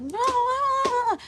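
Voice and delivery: loud, very high mock crying; imitated child's wail. Mother imitates kids who get frustrated and cry, wailing. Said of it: "they'll get frustrated and cry".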